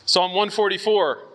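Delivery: ordinary talk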